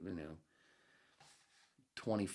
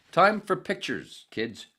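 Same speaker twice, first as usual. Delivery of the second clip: much higher, louder, slow sing-song call; mock teacher announcement; 11 semitones higher, 15 dB louder, slower than usual. Streamer switching to pictures, mock kindergarten teacher call.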